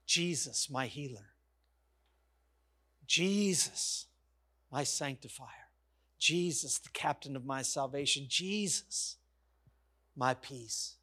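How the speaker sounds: slow down and speak it like worship; hushed worship. Acted. Preacher softly naming Jesus to the church, hushed.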